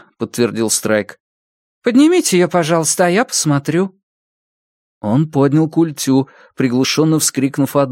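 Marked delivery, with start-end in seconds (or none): 1.8-4.0 brisk questioning doctor; female doctor voice. Acted.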